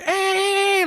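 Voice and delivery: very high imitated calling out; distracting call. Storyteller distracts gunman across the street, calling out.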